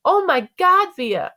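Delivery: high-pitched, loud squeal of excitement; excited scream. A narrator's reading. Mom hugging Via after the play, excited screaming. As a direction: squeal it, overjoyed for her. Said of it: "Mom was screaming with excitement".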